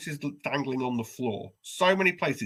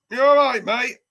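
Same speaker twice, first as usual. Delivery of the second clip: much higher, louder call for help; 11 semitones higher, 10 dB louder than usual. Retold or imitated. Stuck man calling the bloke in the hallway, loud and high. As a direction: call loudly through the door.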